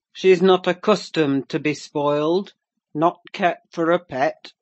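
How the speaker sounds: loud, surly growl; growled rebuke. A narrator's reading. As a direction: growl a gruff warning at the guest. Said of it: "growled Mister Heathcliff".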